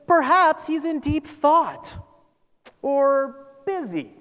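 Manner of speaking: high, loud and drawn out; exaggerated singsong sarcasm; sarcastic mock suggestion. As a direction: taunt them with slow fake helpfulness. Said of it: "He's being sarcastic here".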